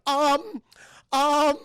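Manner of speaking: loud, high, stretched hesitation; exaggerated objecting "um"; reluctant wife. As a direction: imitate a wife voicing doubt through a drawn hum. Wife dreads where lamp will go.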